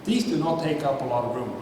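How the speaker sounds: ordinary talk, nothing marked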